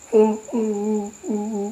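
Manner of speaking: high, wordless mock whimpering; imitated woman crying. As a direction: mimic a woman sobbing in high moans. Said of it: "ada suara cewek nangis".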